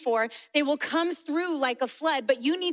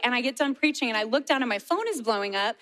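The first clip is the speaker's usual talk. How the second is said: ordinary delivery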